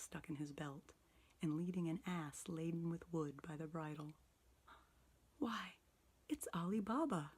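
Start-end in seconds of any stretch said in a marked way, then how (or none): 5.4-7.3 high, delighted cry of recognition; ecstatic exclamation. A narrator's reading. Scrooge recognizing his childhood hero, ecstatic. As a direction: gasp it out with childlike joy. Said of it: "Scrooge exclaimed in ecstasy".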